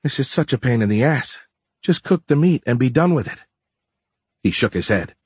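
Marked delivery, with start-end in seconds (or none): 0.0-3.4 impatient. A narrator's reading. Dora complaining impatiently.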